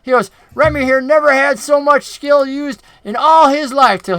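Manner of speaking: goblin boss voice. Acted. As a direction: boast like a greasy goblin boss. Goblin boss brags about Remy.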